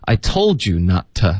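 "to" reduced to "tuh" after "not"; slow linked "told you", weak short "to". Shown as a demonstration. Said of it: "to弱读成t".

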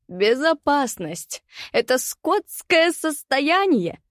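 high, slow, unhinged cackling voice; mad laughing rant. Acted. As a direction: rant through manic laughter. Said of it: "безумно хохоча". (безумный хохочущий крик)

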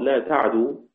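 "لا تعدو" with vowelless ayn and a single dal; light dal. Shown as a demonstration. Teacher giving the other readers' version, "لا تعدو" with silent ayn, light dal. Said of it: "بتخفيف الدال".

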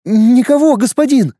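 a high, hurried scared mother; frightened babble. Acted. Mother lies to the warrior in a frightened babble. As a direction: blurt it out in fear. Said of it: "испуганный лепет мамы".

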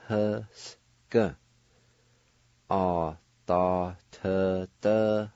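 "A, da, t, d, h, s, g" sounded slowly part by part; teacher modelling each piece of the word; syllable by syllable. Shown as a demonstration. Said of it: "is pronounced like this".

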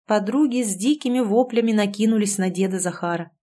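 ordinary delivery

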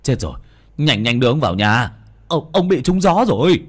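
loud hurried call; urgent call. A narrator's reading. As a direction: hurry them along. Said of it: "Một người kêu lên".

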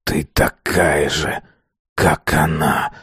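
low, slow hissing accusation; hissed. A narrator's reading. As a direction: hiss it through the anger. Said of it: "прошипел Азар".

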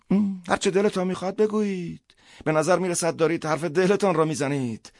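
high-pitched priest, enduring abuse; long-suffering priest. Acted. Priest invites Schnier to insult him, long-suffering.

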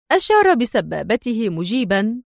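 ordinary delivery, nothing marked

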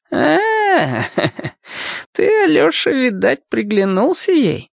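put-on high-pitched, knowing old man's tease; teasing old man. A narrator's reading. Old cobbler teases boy about his granddaughter, knowing.

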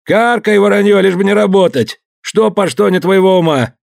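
high furious retort; stung angry shout. Acted. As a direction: lash out at the crowd. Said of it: "Хозяина будто стегнули".

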